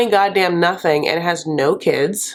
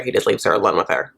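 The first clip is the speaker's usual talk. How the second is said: normal talking voice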